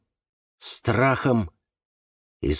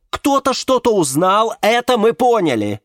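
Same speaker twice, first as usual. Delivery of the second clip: high, loud impatient interjection; interrupting; 11 semitones higher, 6 dB louder than usual. A narrator's reading. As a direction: break in over him impatiently. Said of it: "перебила его Валериана". (нетерпеливо перебить, громко и высоко)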